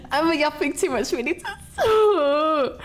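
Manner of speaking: laughing. Real, not acted. Laughing at own rambling.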